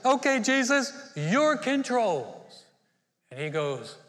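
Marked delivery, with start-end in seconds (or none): 0.0-2.6 high, slow letting-go; mock reluctant yielding; reluctant surrender. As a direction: hand over the controls slowly, giving in. Finally yielding to Jesus.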